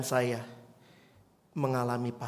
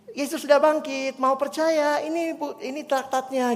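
put-on higher, eager girl's voice offering tracts; cheerful child; 10 semitones higher, 4 dB louder than usual. Pastor voices the eager girl offering tracts to strangers. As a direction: sound like a happy child on a mission. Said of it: "gitu ya".

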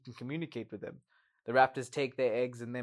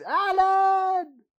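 much higher, louder hammed-up scream of a name; mock scream; 11 dB louder than usual. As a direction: shriek it like a cut-off phone call. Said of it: "while Ellie screams".

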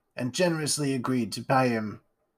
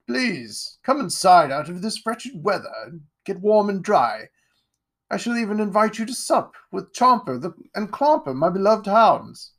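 higher, grand aristocrat voice; pompous lord; 8 semitones higher, 4 dB louder than usual. Acted. Lord Ironwood greeting Jack, pompous and grand. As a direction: puff yourself up like a self-satisfied lord.

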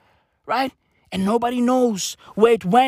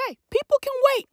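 mocking the government; much faster than usual. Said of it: "our government is like".

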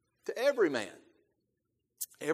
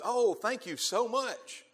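higher, drawn-out thanks, hammed up; mock gratitude; 7 semitones higher, slower than usual. Preacher imagines people in darkness thanking the light-bringer, mock gratitude. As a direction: gush slowly in pretend thanks.

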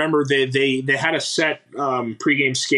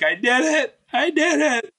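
high, rising mock joy; retold triumph, pitched up; excited; 12 semitones higher than usual. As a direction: relive the thrill of telling mom, climbing higher. Telling mom about the call-up.

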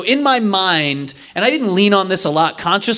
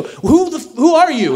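baffled outburst; 12 semitones higher than usual. Retold or imitated. Baffled retort, high.

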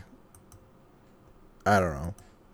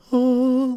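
high held "ooh" imitating eerie music; eerie, high sustained tone; ominous tone; 13 semitones higher than usual. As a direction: mimic an ominous film-score note. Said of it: "like, this ominous, like, tone playing".

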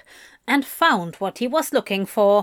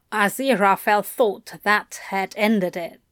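ordinary delivery, nothing marked

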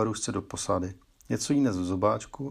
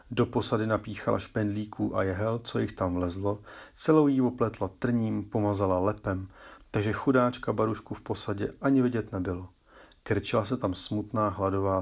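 ordinary delivery

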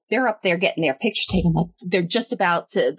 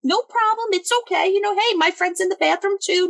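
high, overly sweet reassurance; mock-friendly, pitched up; fake-sweet politeness; 11 semitones higher than usual. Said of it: "I'm just like".

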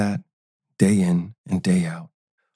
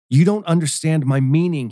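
higher, frustrated pitch; fuming; 7 semitones higher than usual. A narrator's reading. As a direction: push back with rising anger. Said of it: "he fumed".

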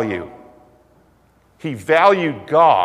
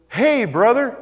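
higher, bright mock-friendly hail; friendly greeting; 8 semitones higher, 3 dB louder than usual. Carpet guy greets the preacher, higher mock-friendly hello. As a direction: greet him like an old pal. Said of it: "And he goes".

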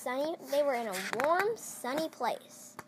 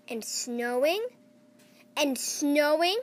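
building louder; 2 semitones higher, 7 dB louder, slower than usual. A narrator's reading. Repeated snowing, building louder.

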